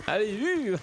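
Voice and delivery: high, loud giddy-up call; urging the horse on. Acted. Rider shooing his horse away, loud high call. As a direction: shout the horse into a gallop.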